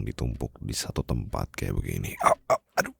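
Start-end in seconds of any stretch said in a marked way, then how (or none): 2.2-2.9 high playful yelps of pain. Acted. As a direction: yelp as if pinched, half laughing. Being pinched repeatedly. (teriakan kecil kesakitan)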